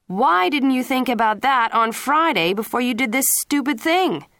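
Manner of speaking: high, loud angry father. A narrator's reading. Father scolding his son for the trip, loud angry shout. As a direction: snap it at him, rising to a shout. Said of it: "Mister Leary said angrily".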